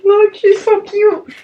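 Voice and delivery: high, gushing squeal; excited gushing. Real, not acted. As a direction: gush over the photo, slow and delighted. Gushing over celebrity engagement news.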